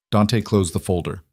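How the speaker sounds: ordinary delivery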